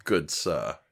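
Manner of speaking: lightly put-on posh British; low stuffy gentleman voice; posh British accent. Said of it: "just a British man that likes film".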